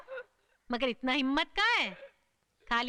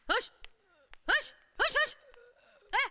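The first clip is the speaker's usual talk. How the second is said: shooing hush; 10 semitones higher than usual. Acted. Shooing the boy away.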